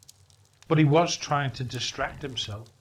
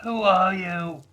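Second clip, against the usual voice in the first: put-on slow, louder old miser's voice asking; half-afraid old miser demanding slowly; Scrooge startled; 5 semitones higher, 5 dB louder than usual. Old Scrooge confronting the ghost, slow and louder.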